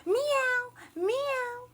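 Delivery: high, loud meows; cat meow. Pretend. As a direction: mew like a cat.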